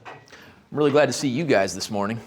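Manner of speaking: low, flat and grudging; sarcastic. Shown as a demonstration. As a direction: say it like you don't mean it at all. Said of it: "check this out".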